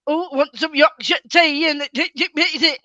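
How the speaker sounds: bad mock Yorkshire accent. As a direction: parody outsiders attempting Yorkshire, loud and drawn out. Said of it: "try and mimic a Yorkshire accent".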